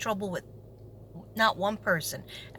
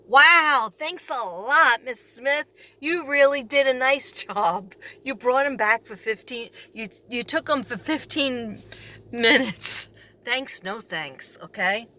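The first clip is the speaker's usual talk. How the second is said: slow, drawn-out mock praise; sarcastic thanks. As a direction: lay the fake gratitude on thick. Sarcastic thanks to absent teacher.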